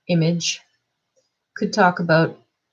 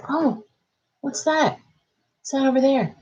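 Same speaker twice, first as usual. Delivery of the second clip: playful distraction; 7 semitones higher than usual. Pretend. Parent diverting a kid from misbehaving, playful curiosity. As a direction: lure a little kid's attention away.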